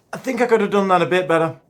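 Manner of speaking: ordinary talk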